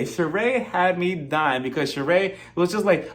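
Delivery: ordinary delivery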